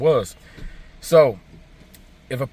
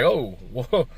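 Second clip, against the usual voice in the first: mock surprise; 5 semitones higher than usual. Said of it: "And we were like".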